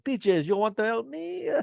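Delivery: playful French chef impression; French chef accent. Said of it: "he's talking French".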